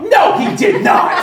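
high, loud, slow shout back; yelled disbelief. Retold or imitated. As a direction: yell back in drawn-out disbelief. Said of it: "And she yelled".

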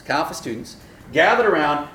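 ordinary talk, nothing marked

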